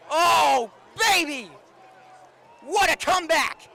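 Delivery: loud joyful yell; excited shout. Real, not acted. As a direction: holler with delight. Celebrating the comeback.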